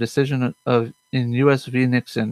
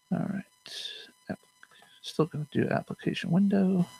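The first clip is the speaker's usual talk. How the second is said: soft, absent-minded mutter; muttering to oneself; 8 semitones higher, 9 dB quieter than usual. Real, not acted. Host talking to himself while finding the application window, muttering. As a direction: murmur it quietly to yourself. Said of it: "Muttering to myself".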